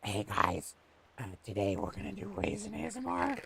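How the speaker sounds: a goblin hosting a raisin ASMR video. Pretend. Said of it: "Oh, you didn't like the voice?".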